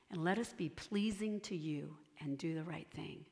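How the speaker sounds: whispered prayer line. Real, not acted. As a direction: breathe the words out as a whisper.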